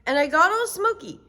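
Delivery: put-on high proud little girl; Sheila's voice. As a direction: brag about the adventure. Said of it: "said Sheila".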